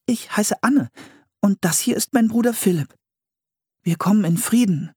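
put-on high, careful child's voice; polite child character. A narrator's reading. Girl introducing her brother to the settlers, high and polite. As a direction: introduce yourselves politely, pitched well up.

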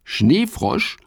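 high, wondering echo of Schneefrosch; puzzled question. Acted. Fips repeats the strange name, puzzled.

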